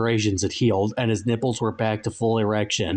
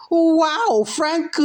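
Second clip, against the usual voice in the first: very high, loud exclamation; Safari Man voice; 5 dB louder than usual. A narrator's reading. Safari Man bursts in, squeaky falsetto.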